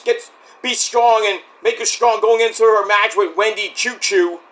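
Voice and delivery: usual speaking voice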